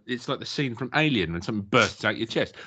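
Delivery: usual speaking voice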